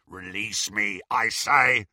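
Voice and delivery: gruff insistent werewolf; werewolf demand. Acted. Greyback to Bellatrix, gruff insistent demand. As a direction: repeat the demand like a cornered beast.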